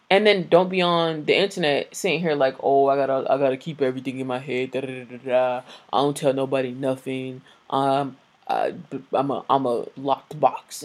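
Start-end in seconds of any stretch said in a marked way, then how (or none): 2.6-10.8 lightly mocking imitation of a secretive man; mock tough guy. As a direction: imitate him with a slightly lowered, droning voice. Mocking men who claim silence.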